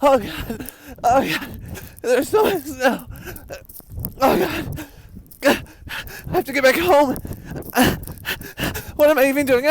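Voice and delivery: shouting over wind and snow; yelling into the blizzard; head out car window. Real, not acted. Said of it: "I can't move my eyebrows".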